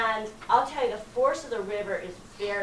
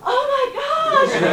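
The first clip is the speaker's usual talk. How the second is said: very high, loud laughing dread; a slow, high, laughing cry of fear; terrified laugh; 13 dB louder, slower than usual. Retold or imitated. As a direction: squeal it slowly through nervous laughter. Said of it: "I'm like".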